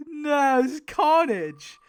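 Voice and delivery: real horrified shout at the carnage; dismayed shout. Real, not acted. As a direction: yell it in disbelief. Six deaths in the finale.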